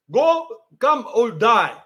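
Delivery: slow, deliberate spelling out; slow translation. Retold or imitated. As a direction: drag each word out. Translation said slowly.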